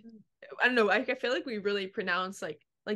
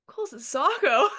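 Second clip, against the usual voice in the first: incredulous question; 5 semitones higher, 6 dB louder than usual. Retold or imitated. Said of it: "And I'm like".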